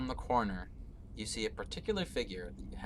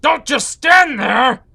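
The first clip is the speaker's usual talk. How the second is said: furious shout; 24 dB louder than usual. Acted. Villain lord yelling at idle Warforged guards, furious shout. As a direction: scream at the guards to get moving. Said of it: "he looks back at these Warforged and he goes".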